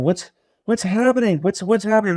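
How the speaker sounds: high-pitched, alarmed questioning; retold alarm. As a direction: re-enact a patient's confused alarm, pitch jumping up. Retold panic while rushed down hall.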